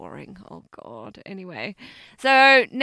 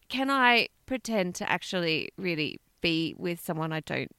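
usual speaking voice

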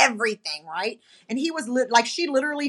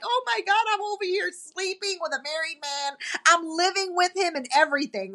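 retold crying; 6 semitones higher than usual. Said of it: "I'm crying, literally like".

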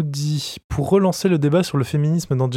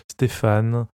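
ordinary talk, nothing marked

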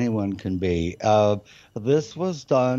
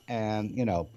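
ordinary delivery

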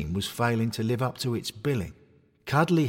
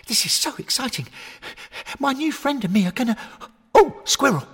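much higher, loud overjoyed puppy; excited dog; 5 dB louder than usual. Acted. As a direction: yelp it like a thrilled puppy.

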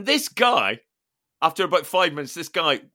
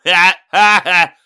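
loud braying ha-ha laugh; loud mocking guffaw; 3 semitones higher, 12 dB louder, slower than usual. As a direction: bray it out like a bloke in a van. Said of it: "I hear him going".

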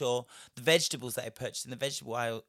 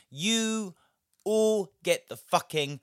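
emphatic award; 5 semitones higher, 7 dB louder, slower than usual. Acted. Awarding the belt.

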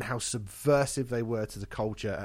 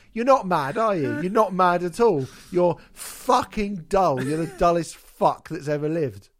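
louder, higher contemptuous jeer; scornful mockery; 4 semitones higher, 6 dB louder than usual. Telling the receptionist she's dull, loud scornful jeer. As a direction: spit out the scorn, dragging out "fucking dull".

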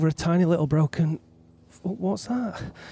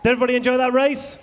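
fast, loud, higher call to the crowd; crowd-rousing shout; 6 semitones higher, 3 dB louder, faster than usual. Real, not acted. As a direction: fire up the crowd like an MC. Hosting podium, rousing crowd.

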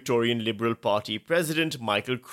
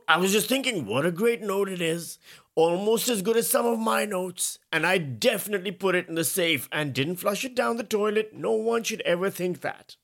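Trump impression; 9 semitones higher than usual. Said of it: "My Trump impression is terrible".

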